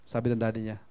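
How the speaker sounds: ordinary talk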